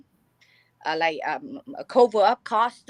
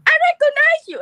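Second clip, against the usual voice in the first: high, loud cry of recognition; excited audience shout; 7 dB louder than usual. Retold or imitated. Audience member interrupting singer's set, excited high shout. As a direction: yell it out from the crowd, starstruck. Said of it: "suddenly audience say".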